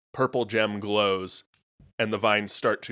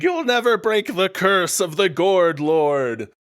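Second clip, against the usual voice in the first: villain taunt; 8 semitones higher, 5 dB louder than usual. Acted.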